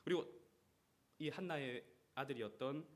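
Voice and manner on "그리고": ordinary talk, nothing marked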